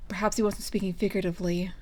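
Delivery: ordinary delivery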